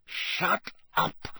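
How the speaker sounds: sharp hissed command. A narrator's reading. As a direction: hiss it to silence him. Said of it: "Snape hissed to Filch".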